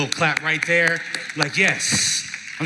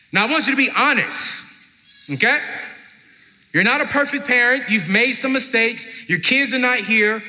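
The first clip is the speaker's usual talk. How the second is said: projecting; 8 semitones higher, 4 dB louder than usual. Real, not acted. Exhorting the audience from the stage.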